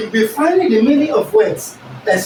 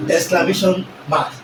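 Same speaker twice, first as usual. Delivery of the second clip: normal talking voice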